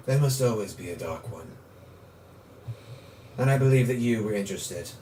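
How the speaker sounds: a calm dark lord judging the hero; sinister villain. Acted. GM voices villain at length.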